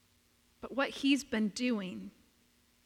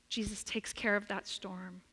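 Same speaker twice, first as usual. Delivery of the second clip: ordinary delivery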